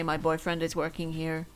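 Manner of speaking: ordinary talk, nothing marked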